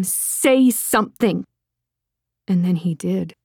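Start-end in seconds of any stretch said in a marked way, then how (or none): 0.0-1.5 high, drawn-out, restrained cry; desperate plea, just short of screaming; wanting to scream. A narrator's reading. Woman desperate to break his awful silence, near scream. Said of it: "made Lydia want to scream".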